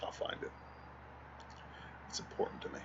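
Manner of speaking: whispered, breathy aside. Real, not acted. Telling friend the meme matters, whisper. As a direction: whisper it to yourself. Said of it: "whisper after search".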